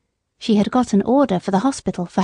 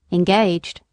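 plain reading voice

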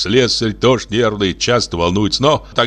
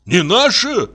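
high, incredulous cry; brother's outraged retort; indignant shout; 10 semitones higher than usual. A narrator's reading. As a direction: throw the words back at her, pitched up. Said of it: "закричал и Костя".

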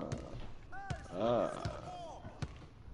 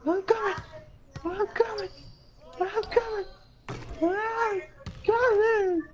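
very high, squealed play-anger; mock rage. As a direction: squeal out a pretend fit of rage.

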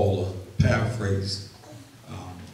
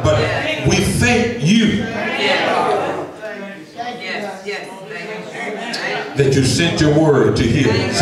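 louder, rising, shouted praise; fervent preaching prayer; 7 semitones higher, 11 dB louder than usual. Acted. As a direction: build the prayer into a full church shout.

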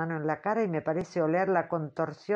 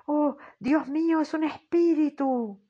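high, loud cry of awe; frightened shout; 9 semitones higher, 5 dB louder than usual. Acted. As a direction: scream it out as a spirit appears. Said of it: "oigo a todas gritar".

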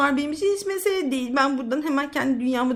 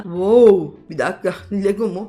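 amazed; 8 semitones lower, 6 dB louder than usual. Acted. Sees giant Lego.

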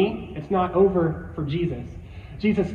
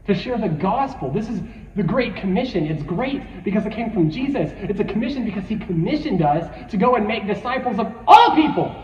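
normal talking voice

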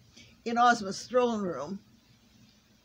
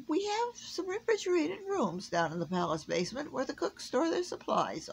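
musing; 9 semitones higher than usual. A narrator's reading. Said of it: "Ozma mused".

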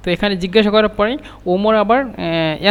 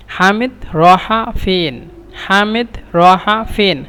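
half-speed model phrase for learners; slow teaching repetition; much slower than usual. Shown as a demonstration. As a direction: say it slowly, word by word, drawn out. Teacher repeats phrase slowly for learners. (ধীরে ধীরে, টেনে টেনে শেখানোর ভঙ্গিতে)

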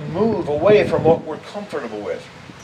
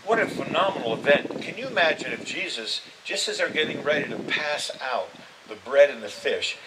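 usual speaking voice

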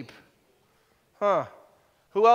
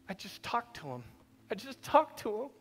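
hushed disbelief; 13 dB quieter than usual. Retold or imitated. Mourner's disbelief.

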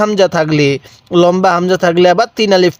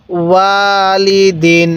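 ওয়ালিদিন very slow, vowels held level; recitation model; much slower than usual. Shown as a demonstration. Teacher showing the learner how to read, drawn-out ওয়ালিদিন. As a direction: chant ওয়ালিদিন with a long first syllable. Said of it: "আরেকবার".

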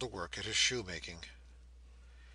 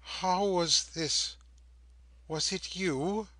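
very slow, higher, louder questioning; coherent but slow; 8 semitones higher, 5 dB louder, much slower than usual. A narrator's reading. Prisoner turns suddenly upon his daughter, speaking slowly. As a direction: draw out each word in dawning wonder. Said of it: "coherently, though slowly".

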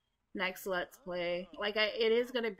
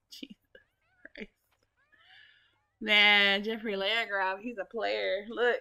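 normal talking voice

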